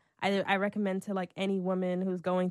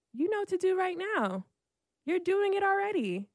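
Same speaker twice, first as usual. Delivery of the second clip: high, deliberate imitation of a wise singer; spaced-out, high-pitched words of assurance; sage reassurance; 10 semitones higher than usual. Singer advising her in tears, imitated high and measured. Acts out [name]'s sage advice.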